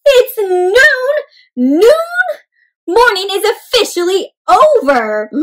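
high, loud alarmed cry; panicked egg. Acted. Shell realises morning is over, high and loud. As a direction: sound the noon alarm.

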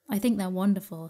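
ordinary delivery, nothing marked

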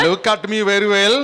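loud, high Indian accent. Shown as a demonstration. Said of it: "do that one in Indian accent".

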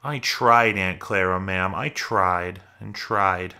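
usual speaking voice

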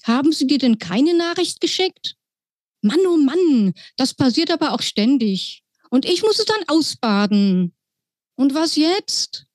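high, chirpy little mouse pilot. Acted. Pilot mouse greets the girl in a high squeaky voice.

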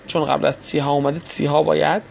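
ordinary talk, nothing marked

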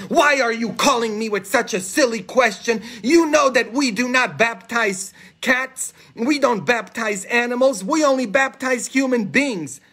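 high-pitched mock scolding; indignant bishop. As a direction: play an exasperated bishop telling off a priest. Bishop scolds priest in joke.

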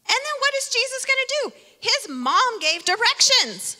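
high, quick teasing question; playful build-up. Acted. Preacher teasing kids about Jesus and mom, high and quick. Kids' sermon, rhetorical build.

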